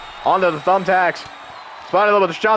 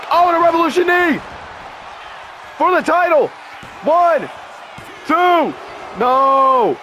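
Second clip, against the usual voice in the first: excited shout; 10 semitones higher than usual. Real, not acted. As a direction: yell the big near fall. Big move, near fall.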